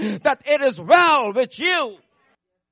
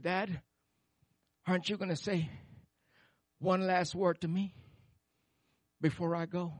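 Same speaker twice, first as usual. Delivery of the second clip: put-on faint dying boy; 2 semitones lower, 8 dB quieter than usual. Dying boy's last words.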